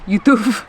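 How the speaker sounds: YOUTUBE as "yoo-TOOF"; Spanish pronunciation. Shown as a demonstration. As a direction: end YouTube on a clear f. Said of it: "With F at the end".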